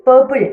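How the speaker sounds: Purple with the weight on പേർ; first-syllable stress. Shown as a demonstration. Teacher gives learners an example, purple stressed at the start. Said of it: "അതിൻ്റെ stress തുടക്കത്തിലാണ്".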